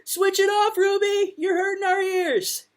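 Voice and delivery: high, loud, annoyed shout; children shouting. A narrator's reading. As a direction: yell at her to stop the noise. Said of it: "They all turned around and shouted".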